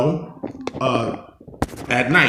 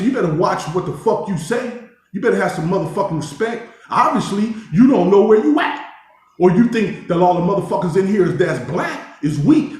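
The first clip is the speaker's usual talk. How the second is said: higher, emphatic re-enacted warning; retold telling-off; 6 semitones higher than usual. Inmate warning another to show respect, retold telling-off.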